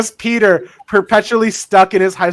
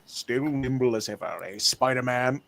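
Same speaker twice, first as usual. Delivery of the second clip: Kingpin voice; 7 semitones lower, 10 dB quieter than usual. Retold or imitated. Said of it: "New Yorker Kingpin accent".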